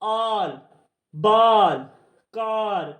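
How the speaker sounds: "आल, बाल, कार" with long open aa; long aa sound. Shown as a demonstration. Teacher drilling the big आ sound, long aa in "आल, बाल, कार". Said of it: "बड़े आ की sound में क्या आया".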